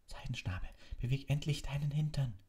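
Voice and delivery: urgent whispered plea; pleading. A narrator's reading. Harry begging the hippogriff to move, whispered plea. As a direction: beg in a strained whisper. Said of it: "flehte Harry".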